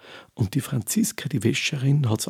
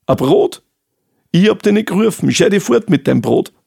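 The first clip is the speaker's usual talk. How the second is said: loud, higher, indignant scolding; angry scolding; 6 semitones higher, 10 dB louder than usual. Acted. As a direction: drive him off in a temper. Grumpy fate woman rejects bread.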